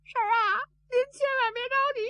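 high, loud wailing plea; tearful pleading. A narrator's reading. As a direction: sob out the words in a high voice. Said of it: "你别哭". (尖声哭着劝慰)

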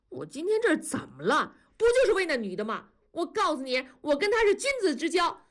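high, indignant protest; defiant yelling. A narrator's reading. Son shielded by mother, high defiant protest. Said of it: "也嚷".

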